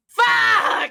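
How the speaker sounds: loud, high, drawn-out curse; venting shout. Acted. Mars venting after the colour puzzle, shouted curse. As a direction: let it all out in one big yell.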